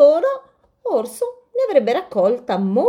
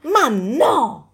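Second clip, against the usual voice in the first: a mild tortoise scolding, higher; 6 semitones higher, 4 dB louder than usual. Acted. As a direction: tell him off gently. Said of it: "lo sgridò Tartaruga".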